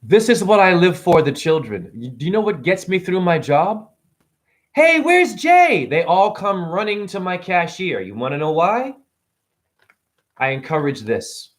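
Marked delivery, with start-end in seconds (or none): 4.7-5.9 put-on high, slow kid's call; excited kid calling. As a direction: call out like an eager child. Kids calling for cashier Jay.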